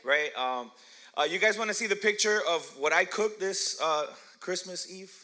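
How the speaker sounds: ordinary talk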